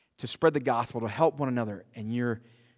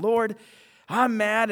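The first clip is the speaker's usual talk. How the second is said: higher, louder, imitating a mad prayer; venting anger at God, half acted; 8 semitones higher, 5 dB louder, much slower than usual. Praying person complains to the Lord, mock anger. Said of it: "where you're just mad".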